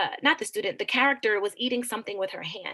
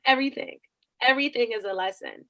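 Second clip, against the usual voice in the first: ordinary delivery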